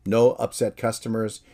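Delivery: ordinary delivery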